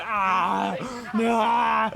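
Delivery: scream in agony. Acted. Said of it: "It's just like".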